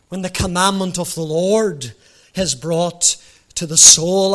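ordinary talk

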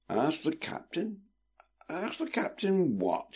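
high, soft, slow and drowsy questioning; reply as if half asleep; sleepy. A narrator's reading. Brother roused by sister's request, replying sleepily. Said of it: "he replied sleepily".

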